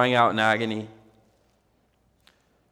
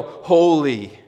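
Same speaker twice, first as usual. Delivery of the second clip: louder call of praise; yelled holy; 4 semitones higher, 5 dB louder than usual. Shown as a demonstration. Preacher to congregation, loud shout of worship. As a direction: lift it up as a shout. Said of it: "You wanna yell".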